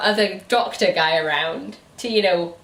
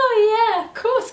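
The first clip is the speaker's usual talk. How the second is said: laughing; 13 semitones higher than usual. Real, not acted. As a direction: burst out laughing in mock agreement. Sarcastic laugh-shout.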